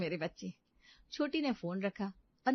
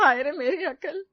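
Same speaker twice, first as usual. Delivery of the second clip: lament; 7 semitones higher, 9 dB louder than usual. Acted. Mother laments.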